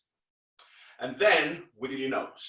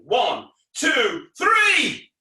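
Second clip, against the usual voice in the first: showman's rousing countdown; shouted count-in; 7 semitones higher, 7 dB louder, much slower than usual. Pretend. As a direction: build to a high, loud "three".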